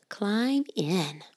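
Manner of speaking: soft hushed storybook whisper; whispered story reading. Acted. Mother reading to children in a soft whisper. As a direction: hush it like a bedtime book.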